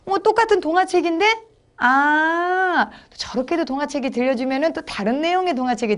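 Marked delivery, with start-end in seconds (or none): 0.0-2.9 put-on high, puzzled child voice; high-pitched curious reaction; surprised pupil. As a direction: imitate a child noticing something, then catching on. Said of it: "그렇게 하면은 아이들이".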